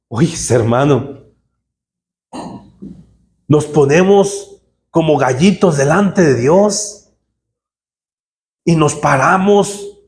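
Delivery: usual speaking voice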